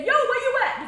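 loud, high joking holler; mock calling out. Grandmothers would never call this, mock holler. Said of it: "But they wouldn't say".

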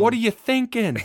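incredulous squeaky outburst; high, disbelieving scold; exasperated high voice. Acted. Mocking old generals.

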